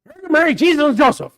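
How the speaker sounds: imitating the ranch foreman. Pastor retelling his ranch foreman's oath, mock exclaimed. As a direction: belt out the oath like the foreman did. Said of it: "You know?".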